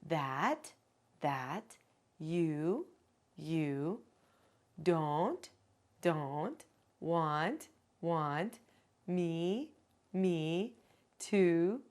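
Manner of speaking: put-on each word said twice, slowly, as a child repeats. Shown as a demonstration. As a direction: model then echo, one word at a time. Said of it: "give her each little phrase".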